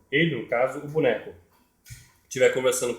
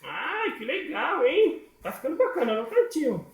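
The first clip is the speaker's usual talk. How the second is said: very high, delighted puppet voice; high falsetto puppet chatter; puppet granny. Pretend. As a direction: squeal happily as the granny puppet. Puppet granny answers presenter.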